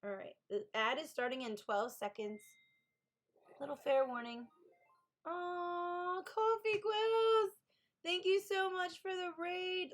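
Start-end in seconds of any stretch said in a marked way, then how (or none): 5.3-9.9 high, slow, drawn-out delighted welcome; excited greeting. Real, not acted. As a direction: gush over the raider's name. Greeting incoming raid excitedly.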